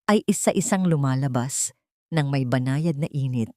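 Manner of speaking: ordinary delivery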